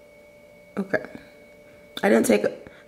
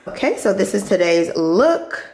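usual speaking voice

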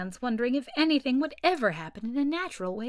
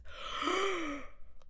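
yawn; 8 semitones higher, 8 dB quieter than usual. A narrator's reading. Mock Turtle yawns.

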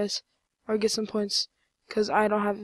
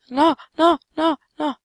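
high, louder burst of no's; panicked protest; 6 semitones higher, 5 dB louder than usual. Real, not acted. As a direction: cry each no out in gaming panic. Zombies are killing the player.

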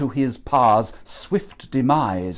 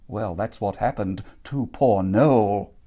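usual speaking voice

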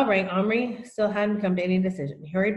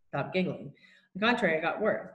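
plain reading voice

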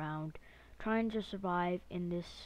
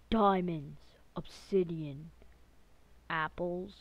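excited; 5 dB louder, slower than usual. Real, not acted. Finds loot with a gasp.

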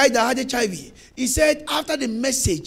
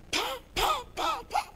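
high mock slap sounds; lightly imitated slapping noise; mimicked slaps; 13 semitones higher, 8 dB quieter, slower than usual. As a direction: voice the slaps in a squeak. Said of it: "Talking like".

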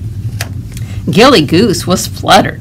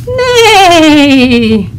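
loud, high horse neigh, falling; whinny like a horse; bellowed neigh; 9 semitones higher, 5 dB louder than usual. Acted. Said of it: "bellowed out a".

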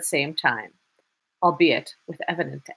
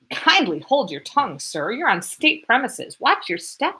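incensed warning; 6 semitones higher than usual. Acted. Incensed lieutenant sputters.

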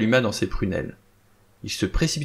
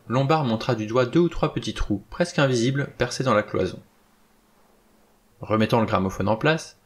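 ordinary delivery, nothing marked

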